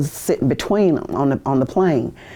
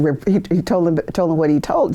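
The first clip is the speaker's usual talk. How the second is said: ordinary delivery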